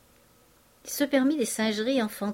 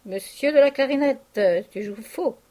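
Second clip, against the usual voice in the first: a hoarse, higher, louder showman scolding; 4 semitones higher, 4 dB louder than usual. A narrator's reading. Vautrin scolding the imaginary clarinettist, hoarse barker voice. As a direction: rasp at the clarinettist like a worn-out barker. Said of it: "reprit-il d'une voix enrouée".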